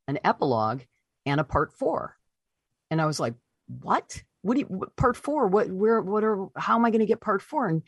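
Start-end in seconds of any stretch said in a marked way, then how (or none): 3.7-7.7 high-pitched, stumbling retold bafflement; baffled disbelief. Author reacting to news of a part four, baffled. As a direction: stammer out questions in rising disbelief.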